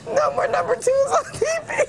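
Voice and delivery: high-pitched laughing through the news; helplessly giggling, can't read on; anchor stifling giggles. Real, not acted.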